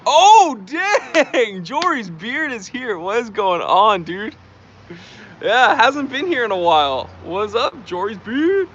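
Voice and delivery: loud, high-pitched burst of surprised delight; excited greeting. Real, not acted. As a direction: hail a long-lost regular, drawing the words out. Spots a familiar viewer in chat.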